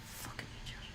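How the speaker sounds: unvoiced, breathy curse under the breath; whispered insult. Real, not acted. Streamer cursing at Lorne's photo under her breath. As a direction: hiss it to yourself.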